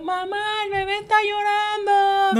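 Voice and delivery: canturreo agudo y lento; inocencia fingida. Retold or imitated. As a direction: finge que nada pasó. Avisa a mamá inocentemente. (la hermana avisa a mamá fingiendo inocencia, cantadito)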